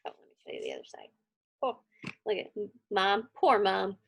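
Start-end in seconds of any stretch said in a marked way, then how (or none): none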